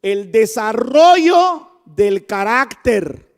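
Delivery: loud, slow emphatic preaching. Acted. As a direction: preach it out with full force. Slow emphatic sermon phrase. (predicación enfática y lenta)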